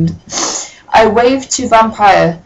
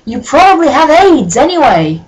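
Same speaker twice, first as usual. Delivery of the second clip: higher, louder mock venom; hammed-up taunt; 7 semitones higher, 4 dB louder than usual. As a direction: spit out the insult theatrically. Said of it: "I shouted".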